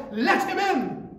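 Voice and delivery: high, loud command "Let him in"; commanding shout. Acted. God ordering heaven to let the believer in, shouted.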